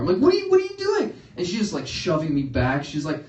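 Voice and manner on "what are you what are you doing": very high, loud flustered stammer; panicked disbelief. Retold or imitated. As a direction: splutter in high-pitched shock.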